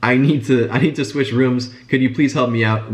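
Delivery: usual speaking voice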